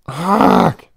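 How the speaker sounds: a fed-up burst of noise; frustrated growl. Acted. Frustrated at the chatbot.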